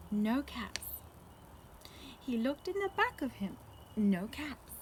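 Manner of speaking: ordinary delivery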